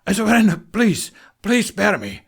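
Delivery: high, loud, panicked pleading; desperate begging. A narrator's reading. Trapper begging the heroes to spare him. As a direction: beg for your life.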